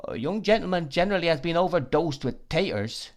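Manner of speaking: ingratiating waiter patter; insinuating waiter. A narrator's reading. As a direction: confide knowingly through an insinuating smile. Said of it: "with an insinuating smile".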